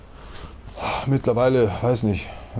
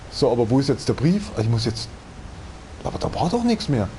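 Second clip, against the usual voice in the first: ordinary delivery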